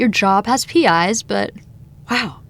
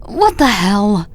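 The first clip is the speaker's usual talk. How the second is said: shocked exclamation; 4 dB louder, much slower than usual. Acted. Finds ex-girlfriend unexpectedly.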